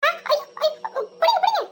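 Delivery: sudden high frightened yelps, calling for someone to catch her; panicked high call to be caught; startled cry for help. Acted. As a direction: cry out as you lose your footing. She trips and nearly falls.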